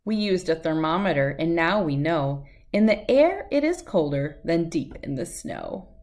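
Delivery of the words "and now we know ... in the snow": ordinary delivery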